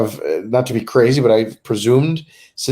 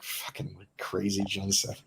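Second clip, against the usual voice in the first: whispered, slow grumble; grumbling whisper; 3 semitones lower, 14 dB quieter, slower than usual. Retold or imitated. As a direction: mutter it under your breath. Imitating reluctant friends grumbling.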